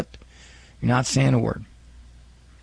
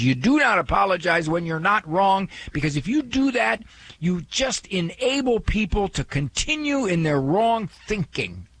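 caught-up caller; 7 semitones higher than usual. Retold or imitated. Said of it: "so caught up in the point".

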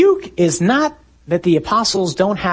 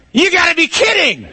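high, exasperated holler over wind; incredulous yell; 4 dB louder than usual. Acted. Disciple reacting to Jesus in the storm, incredulous shout. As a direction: yell it back in comic disbelief.

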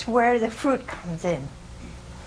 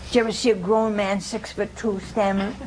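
ordinary talk, nothing marked

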